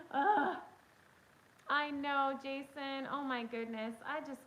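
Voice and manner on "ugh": laughing. Real, not acted. Laughing at herself.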